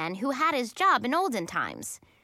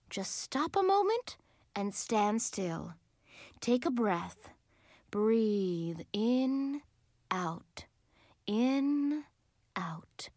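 calming; much slower than usual. A narrator's reading. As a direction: ease the children down, breath by breath. Leader calms children with breathing exercise.

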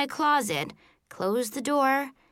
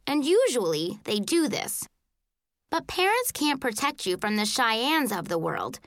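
ordinary delivery

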